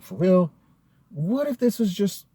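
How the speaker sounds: high-pitched retold disbelief; acting out skeptical second thoughts; incredulous doubt. Acting out own doubts about the story.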